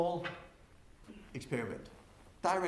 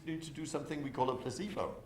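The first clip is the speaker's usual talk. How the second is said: ordinary talk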